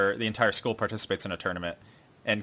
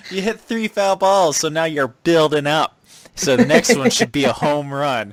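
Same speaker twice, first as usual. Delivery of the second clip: loud, raised-voice encouragement; backhanded cheering; 4 semitones higher, 10 dB louder than usual. Retold or imitated. Childhood friend cheers him on, loud backhanded cheer. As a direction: cheer loudly with a backhanded edge. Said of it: "a very backhanded, like, type thing".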